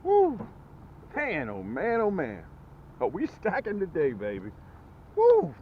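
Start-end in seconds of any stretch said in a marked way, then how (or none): 0.0-0.5 high, loud whoop of joy; excited whoop. Real, not acted. Fish biting fast. 5.2-5.5 high, loud whoop of joy; excited whoop. Real, not acted. Another fish landed.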